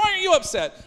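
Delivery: very high-pitched mock retort; indignant retort. Passenger firing back at the calm driver, high mock indignation. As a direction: imitate a worked-up passenger, voice shooting up.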